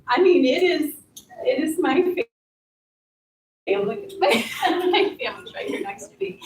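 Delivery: teary, voice catching; fighting back tears, laughing through them; after crying. Real, not acted. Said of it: "I'm sorry for being teary".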